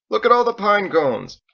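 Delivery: bright loud call, pitched high. Acted. John points the boys at the cones, loud and high. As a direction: call the boys over, cheerful.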